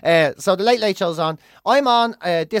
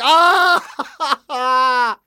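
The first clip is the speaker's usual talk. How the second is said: a hammed-up cry of horror, louder; mock scream; 5 semitones higher, 6 dB louder than usual. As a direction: cry out as if the joke hurts. Imitated dread at a bad joke.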